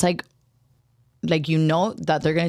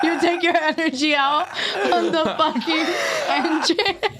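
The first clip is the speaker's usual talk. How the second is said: laughing; 8 semitones higher than usual. Real, not acted. Laughing while teasing.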